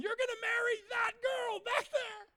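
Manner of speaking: very high, loud yell; shouted prophecy. Retold or imitated. Preacher prophesying marriage to a kid, high full shout. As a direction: bellow the prophecy at the top of the range.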